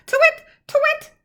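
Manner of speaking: high calling "twit, twit"; owl call. A narrator's reading. Olive calling from her tree, high owl call. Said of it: "called out".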